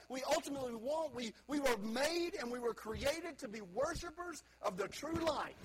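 put-on high, loud, rising preacher's call to the young; preaching at full force. Preacher urging young people, lifted high and loud. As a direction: let it ring out like a revival sermon.

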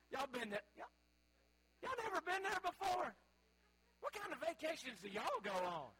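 high-pitched, playful incredulity; mock disbelief. Mock disbelief at silent audience.

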